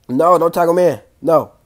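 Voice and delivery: urgent loud protest; pleading shout. Real, not acted. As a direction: yell at the screen to stop it. Begging game not to tag.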